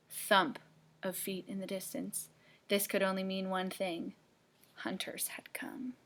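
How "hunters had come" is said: spooky whisper; ominous storyteller whisper. Acted. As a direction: hush it like danger is near.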